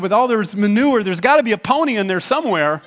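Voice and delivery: lightly voiced gleeful girl; excited little girl. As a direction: sell it like a hopeful kid. Optimistic girl answering dad.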